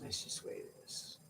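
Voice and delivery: breathy aside to himself; whispered aside. Real, not acted. Muttering to himself after answering the viewers. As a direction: whisper it under your breath.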